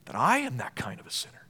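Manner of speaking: hushed, unvoiced whisper; whispered confession. Real, not acted. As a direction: breathe it as a private confession. Intimate confession in sermon.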